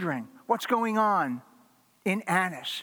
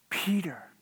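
whisper; 3 dB quieter than usual. Acted. Preacher whispers Peter's name.

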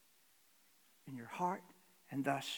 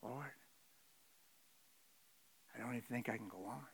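soft, fast, hopeless murmur; hushed despair; 5 semitones lower, 10 dB quieter, faster than usual. Retold or imitated. Depressed soul voiced quietly to God, hopeless. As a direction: barely say it, all hope gone.

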